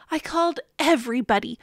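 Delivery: usual speaking voice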